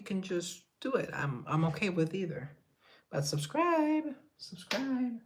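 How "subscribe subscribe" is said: high sing-song plea to subscribe; playful subscribe plea. Acted. Streamer begging chat to subscribe, high sing-song. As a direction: coax viewers in a high lilting voice.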